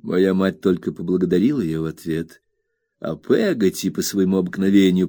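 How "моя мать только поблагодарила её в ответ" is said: ordinary delivery, nothing marked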